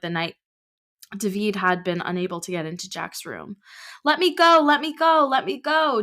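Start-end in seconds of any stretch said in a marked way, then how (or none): 4.0-6.0 high, loud desperate shout. Acted. Possessed Jim shouting at his captors, loud desperate shout. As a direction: scream to be let go. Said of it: "Jim shouted".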